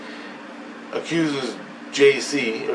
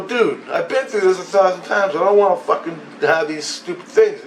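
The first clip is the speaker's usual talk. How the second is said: raised, fed-up protest; retold exasperation; 6 semitones higher than usual. Man telling the voice he's sick of this, exasperated. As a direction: protest higher, as in a retold argument.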